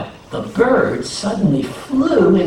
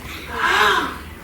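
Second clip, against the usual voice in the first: gasp. Acted. Listeners react to hot beans.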